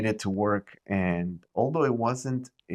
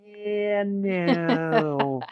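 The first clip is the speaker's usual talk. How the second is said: high, dismissive refusal; mock bank clerk; 10 semitones higher, 4 dB louder than usual. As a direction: brush it off like a snooty banker. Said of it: "And they were like".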